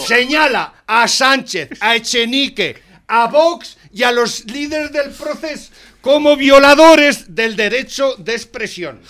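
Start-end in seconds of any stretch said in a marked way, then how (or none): 0.0-7.3 slow and louder, word by word; emphatic spelling out. Real, not acted. Host reads out who the report names, slow and loud. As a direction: hammer each name home slowly.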